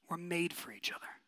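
breathy whispered aside; an intimate whispered truth; hushed whisper. Real, not acted. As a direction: whisper it like a secret. Intimate point in sermon.

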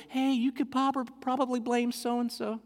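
imitating a voice in one's head; inner tempter voice. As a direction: play the inner voice nudging toward an excuse. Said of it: "there's that inside that goes".

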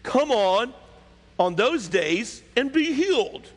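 high, drawn-out pompous scolding; indignant ruler's tone. Acted. Synagogue ruler scolding the people for seeking healing, indignant. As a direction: lecture them with haughty, stretched-out indignation. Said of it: "he said it in this tone".

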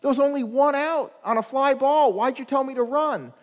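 put-on a puzzled eight-year-old pleading; kid asking coach. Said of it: "I said".

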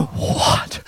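hushed, voiceless astonishment; shocked whisper. Retold or imitated. Sister seeing her brother weep, shocked whisper. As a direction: whisper it in stunned disbelief.

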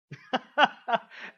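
a short burst of loud laughter; bright laugh. Real, not acted. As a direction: laugh out loud, high and free. Laughs after mock prayer.

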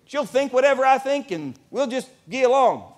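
naive newlywed. Retold or imitated. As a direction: announce it with breezy overconfidence. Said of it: "like, going".

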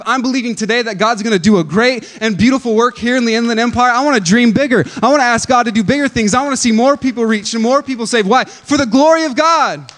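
full-force preaching. Acted. Pastor rallying the church for bigger faith, fervent and loud. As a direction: rouse the congregation to dream bigger.

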